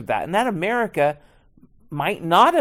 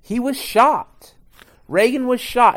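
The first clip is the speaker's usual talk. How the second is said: dramatic reveal; 8 semitones higher, 6 dB louder than usual. Acted.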